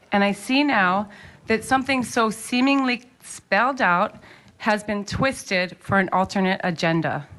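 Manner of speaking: ordinary delivery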